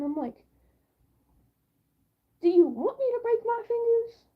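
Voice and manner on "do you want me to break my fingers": high, louder mock outrage; indignant high retort. Answering his bad advice about flat hands, high and indignant. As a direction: throw the question back at him.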